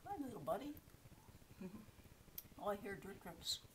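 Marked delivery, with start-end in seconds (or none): 0.1-0.7 cute greeting. Real, not acted. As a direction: coo at it like a pet. Greeting a fossil sea urchin.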